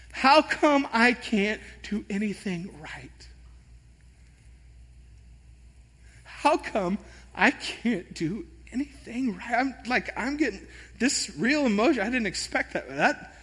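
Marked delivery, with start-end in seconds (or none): 0.1-9.5 slow, choked lament; tearful. Retold or imitated. Mechanic failing, slow tearful self-reproach. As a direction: ask it slowly through tears. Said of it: "with tears welling up in my eyes".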